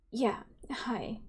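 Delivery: soft breathy whisper. Acted. Awkward greeting to returning May, whispered.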